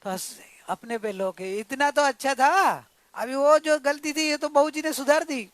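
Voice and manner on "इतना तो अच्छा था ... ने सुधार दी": louder, higher, acted-out remark; emphatic quoted remark. Preacher acts out the man's verdict on himself, emphatic. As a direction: raise the voice as if making a point.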